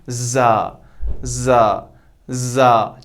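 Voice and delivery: जा, teeth closed, buzzing z; za sound. Shown as a demonstration. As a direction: join the teeth and buzz जा. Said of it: "ऊपर वाले दो दांत और नीचे वाले दो दांत को मिला के".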